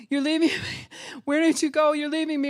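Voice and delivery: high, loud pleading wail; panicked child. Retold or imitated.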